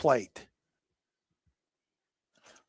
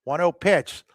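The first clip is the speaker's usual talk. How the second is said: normal talking voice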